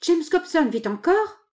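high, slow incredulous question; stunned, pitch leaping up; astonished question. A narrator's reading. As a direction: ask it in sheer disbelief. Women learn he still lives.

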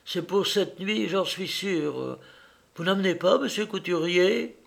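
put-on high-pitched, anxious old maid; worried old housekeeper.

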